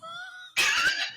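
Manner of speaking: high squealing laugh; real, high-pitched cackle. Real, not acted. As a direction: burst out in a high, long laugh. Laughs at being insulted.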